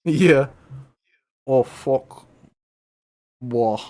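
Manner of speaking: ordinary talk, nothing marked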